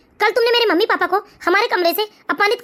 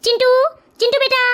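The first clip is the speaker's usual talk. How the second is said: higher-pitched call to a son; 4 semitones higher than usual. Acted. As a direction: call the child in from another room. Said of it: "मैं आवाज लगाता हूँ". (दूर से बेटे को आवाज़ लगाते हुए)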